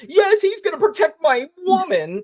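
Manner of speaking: high-pitched hammed-up cheering; cheering dumb fan. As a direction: squeal like a gullible fan. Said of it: "who is the guy cheering".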